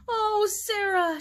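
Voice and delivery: drawn-out high plaintive moan, slowed right down; a bride-to-be moaning to her sister, high and slow. A narrator's reading. A big sister moaning to Sarah for help, high and drawn out. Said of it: "she moaned".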